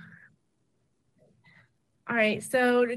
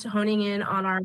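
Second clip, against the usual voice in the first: ordinary talk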